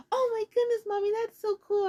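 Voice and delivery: put-on high, thrilled child voice; excited kids. Kids tell Mommy the video is cool, high excited squeal.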